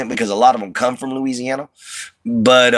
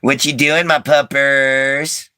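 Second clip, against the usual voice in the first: higher doting dog-talk, last word drawn out; pet talk; 6 semitones higher, slower than usual. Real, not acted. Owner greeting his puppers, doting pet talk. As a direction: coo to your dog, stretching the end.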